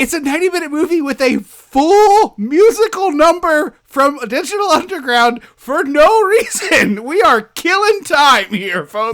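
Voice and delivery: high, loud, laughing outrage; exasperated rant. Real, not acted. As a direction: belt out the complaint in comic exasperation. Comic rant about padded running time.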